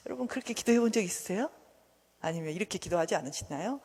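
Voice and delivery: ordinary delivery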